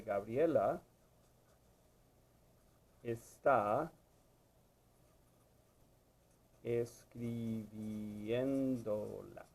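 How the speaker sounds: word by word, last word hugely stretched; slow dictation. Shown as a demonstration. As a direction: stretch escribiéndola out syllable by syllable. Dictates the new sentence while writing.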